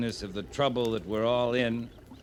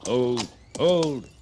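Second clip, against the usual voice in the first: raised voice calling ahead to halt someone; 5 dB louder than usual. Acted. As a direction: call out firmly to stop them. Soldier calls out on trail.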